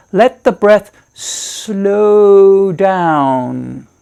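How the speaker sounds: SLOW DOWN stretched very long, gliding out. Shown as a demonstration. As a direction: draw out slow down like a car coasting to a halt. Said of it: "like a car coasting".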